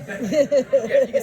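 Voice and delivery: laughing through the words. Real, not acted. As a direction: chuckle while you talk. Guide laughs at his own tall tale.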